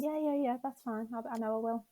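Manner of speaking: brave brush-off. Retold or imitated. Brushing off family's comfort.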